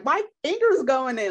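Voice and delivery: high, loud giddy squeal; thrilled at her own progress; giddy delight; giddy excitement. Retold or imitated. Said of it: "I'm like".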